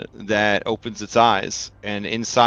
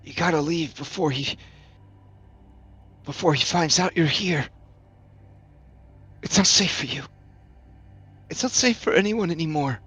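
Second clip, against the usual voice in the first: high, strained warning; halting, urgent plea in a raised pitch; crackling speaker voice; 7 semitones higher than usual. Acted. Grom warns the visitors to leave, crackling speaker voice. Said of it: "the voice on the little speaker".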